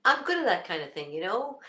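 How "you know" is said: ordinary talk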